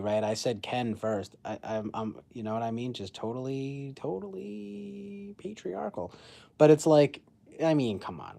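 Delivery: ordinary talk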